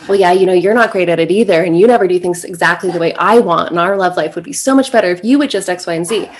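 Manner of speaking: mock-defensive retort, rushed and loud; defensive snapping back. Shown as a demonstration. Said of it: "getting defensive and snapping back".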